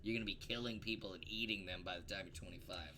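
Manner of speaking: hushed, conspiratorial whisper. Acted. Joking that child chefs become killers, whispered. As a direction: lean in and whisper the dark joke. Dark joke, murmured.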